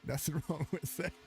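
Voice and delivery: laughing through the words; amused, laughing. Real, not acted. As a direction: chuckle as you repeat it. Repeats it laughing.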